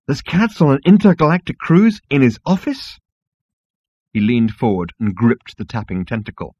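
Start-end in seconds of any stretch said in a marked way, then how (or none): 0.1-3.0 high, rising disbelief; incredulous. Acted. Zaphod questions the receptionist's absurd answer, incredulous. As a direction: query it in total disbelief.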